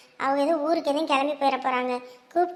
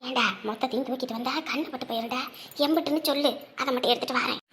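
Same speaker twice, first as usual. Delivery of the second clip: hushed aside; 4 semitones lower, 8 dB quieter than usual. Acted. Mother hides money from son.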